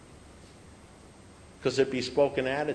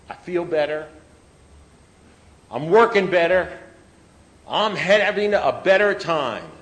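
mildly hammed-up self-assured claim, growing louder; boastful drinker; 6 semitones higher, 8 dB louder than usual. Dry drinker telling everyone he feels better, mock boasting. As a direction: lightly mimic a drinker insisting all is well.

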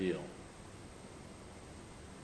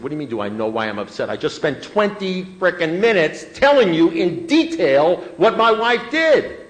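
exasperated outburst; 6 semitones higher, 8 dB louder than usual. Retold or imitated. Man retells blowing up at his sponsor, exasperated outburst. Retold outraged reply to sponsor.

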